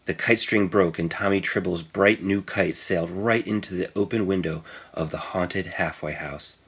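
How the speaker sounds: ordinary delivery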